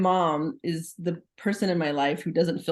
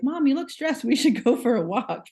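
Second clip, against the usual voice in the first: put-on higher-pitched child addressing mom; imagined kid; 6 semitones higher, 4 dB louder than usual. Kid suggesting a better remedy to stressed mom, playfully imitated. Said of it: "maybe it should be like".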